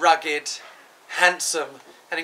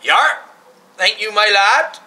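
put-on Cornish accent; 3 semitones higher, 6 dB louder than usual. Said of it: "accent like my Auntie Lola from Cornwall".

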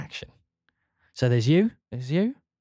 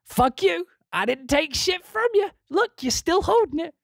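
high, mocking kid's voice; squeaky little thief sneering back; bratty kid voice; 14 semitones higher than usual. Mocking Timmy as he walks away, high bratty voice. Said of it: "he walks away and says".

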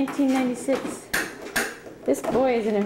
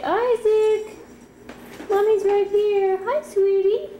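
higher, tender sing-song; 7 semitones higher than usual. Real, not acted. Mother soothes startled baby.

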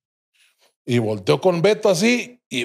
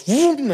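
whoosh imitation; 13 semitones higher than usual. Cars rushing in.

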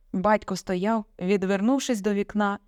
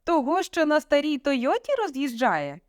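high-pitched indignant question; raised voice; 8 semitones higher than usual. A narrator's reading. Father sneering at daughter's boyfriend, voice raised. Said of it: "підвищив голос".

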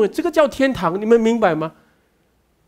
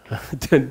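short scoffing laugh; 6 semitones lower, 4 dB quieter than usual. Real, not acted. Mocking church visions.

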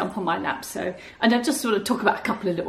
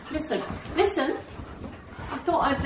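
ordinary talk, nothing marked

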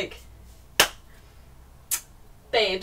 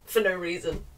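usual speaking voice

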